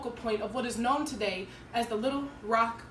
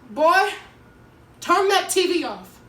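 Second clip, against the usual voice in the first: loud, higher-pitched worried order; upset mother calling out; 6 semitones higher, 9 dB louder, slower than usual. Acted. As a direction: call it sharply from another room. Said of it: "she called from the kitchen".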